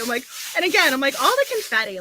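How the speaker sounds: usual speaking voice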